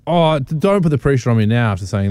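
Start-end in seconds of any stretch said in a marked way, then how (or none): none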